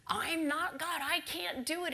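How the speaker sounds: acted indignant refusal; upset. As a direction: protest as an upset king, toned down. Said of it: "And he's all upset".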